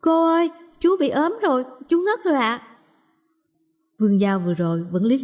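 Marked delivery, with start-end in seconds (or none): 0.0-2.6 put-on high, urgent child's cry for help; child calling out. A narrator's reading. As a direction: call to an adult in a much higher voice. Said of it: "liền chạy đến Vương Giao hét to".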